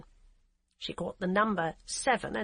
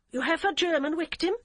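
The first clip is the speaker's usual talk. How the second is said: put-on heavy German accent; 9 semitones higher, 5 dB louder than usual. Hamburg policewoman answering the phone in a curt, heavy German accent. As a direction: clip it like a stiff German officer. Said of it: "with an accent so heavy".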